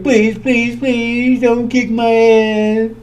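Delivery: slow, drawn-out mock pleading; mock begging. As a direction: beg for mercy, half-joking. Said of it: "He's begging".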